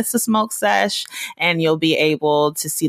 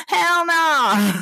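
high, firm repeat of the refusal; hell no again; 10 semitones higher than usual. Real, not acted. Host insisting to listeners she won't get sick, high firm refusal. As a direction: clip it high as a final verdict.

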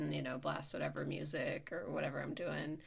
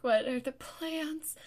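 higher, drawn-out anxious question; retold anxious fretting; 8 semitones higher, 4 dB louder, slower than usual. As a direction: fret it out slowly with the pitch up. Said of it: "so anxious about plan".

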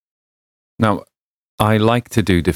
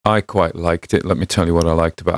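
ordinary delivery, nothing marked

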